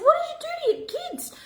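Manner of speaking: high, incredulous demand; shocked question. Retold or imitated. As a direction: act out your disbelief at her.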